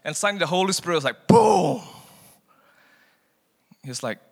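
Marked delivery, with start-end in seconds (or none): 1.3-1.8 sudden boom. Retold or imitated. Holy Spirit hits suddenly.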